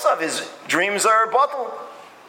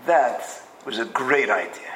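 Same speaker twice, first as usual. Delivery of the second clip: bitterly sarcastic, pitched well down; bitter sarcasm; 6 semitones lower than usual. Retold or imitated. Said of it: "he's bitter and he's upset".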